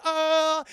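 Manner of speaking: loud, high sustained "oh"; mock action yell. Jackie Chan wall-running on the truck, mock yell. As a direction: holler like running along a wall.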